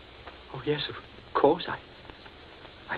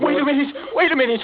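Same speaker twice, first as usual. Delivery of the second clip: high, loud desperate begging; panicked plea; 8 semitones higher, 4 dB louder, much faster than usual. Acted. Captive begs for time facing the gun. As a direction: plead fast for your life.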